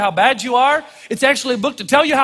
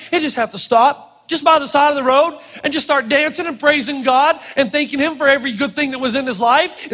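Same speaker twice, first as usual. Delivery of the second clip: ordinary delivery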